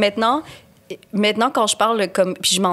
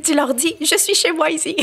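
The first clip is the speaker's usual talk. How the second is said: higher-pitched imitation of a mother; mother's instruction; 9 semitones higher than usual. Mother teaching child a comeback, high emphatic instruction. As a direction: coach the child with insistence.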